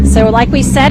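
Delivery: ordinary talk, nothing marked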